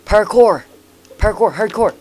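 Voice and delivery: high-pitched, loud parkour cries; mock action shout. Player calling out parkour moves in game, mock shouting. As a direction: shout each word as a stunt call. Calling out stunts while playing.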